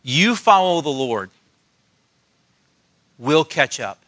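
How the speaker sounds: a gentle hint of mom letting him go; gentle mother. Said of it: "in the most gentle way".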